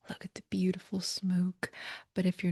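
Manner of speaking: soft breathy whisper. Real, not acted. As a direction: whisper it close and gentle. Cleansing with sage, softly.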